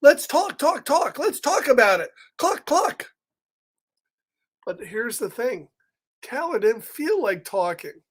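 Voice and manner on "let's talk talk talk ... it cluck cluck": higher, louder chattering hen; chatty chicken. Acted. Reader voicing the talkative chicken. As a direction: cluck eagerly, wanting to chat. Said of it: "the chicken likes to talk a lot".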